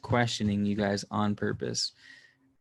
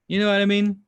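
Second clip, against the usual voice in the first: emphatic plea; 8 semitones higher, 8 dB louder, faster than usual. Real, not acted. Pressing friend to agree.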